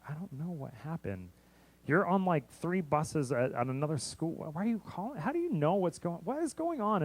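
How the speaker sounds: rising confusion, can't believe they already know; baffled disbelief. Retold or imitated. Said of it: "I'm like".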